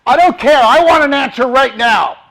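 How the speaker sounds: loud, high-pitched furious yell; angry yelling demand. Shown as a demonstration. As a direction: blast the demand at them like an enraged caller. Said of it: "Let me show you something".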